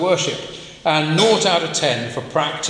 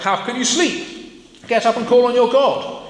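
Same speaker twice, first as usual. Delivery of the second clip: urgent captain; 8 semitones higher, 4 dB louder than usual. Acted. Captain rousing sleeping Jonah.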